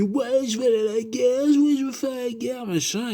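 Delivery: put-on high, overexcited boy keen to enlist; mock eager son. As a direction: crow it like a naive recruit. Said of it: "tu sais, il fait".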